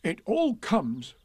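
high, slow, peevish complaint; cross. A narrator's reading. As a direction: grumble it crossly, stretching the words. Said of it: "said Pooh crossly".

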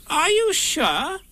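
very high, slow, incredulous question. A narrator's reading. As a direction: squeak it out slowly in disbelief. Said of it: "still more surprised".

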